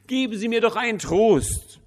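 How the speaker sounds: very high, loud desperate cry; desperate plea. A narrator's reading. Widow begs the pastor for comfort, desperate high cry. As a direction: beg for comfort at the top of the voice. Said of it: "Verzweifelt sah sie mich an".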